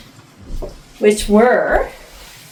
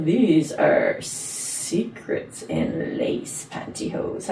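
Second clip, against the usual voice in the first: sultry; 5 semitones lower, 5 dB quieter, much slower than usual. Acted. Sultry slow product read.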